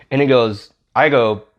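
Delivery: ordinary talk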